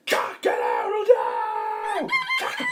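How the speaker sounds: loud, drawn-out cock-a-doodle-doo; cockerel crow. A narrator's reading. Cockerel wakes the farm, full-throated crowing. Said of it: "they heard".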